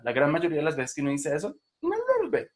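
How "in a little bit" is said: "little" pitched very high, then falling. Shown as a demonstration. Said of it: "sube la frecuencia fundamental".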